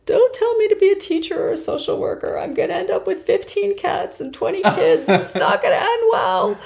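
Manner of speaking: imitating herself crying to her dad, with a laugh at the end; retold tearful protest. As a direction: wail it like a tearful young adult. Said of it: "now I'm crying harder".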